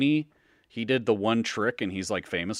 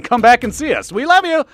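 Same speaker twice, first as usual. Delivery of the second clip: excited shouting sign-off; 12 dB louder than usual. Real, not acted. Hyped sign-off to listeners.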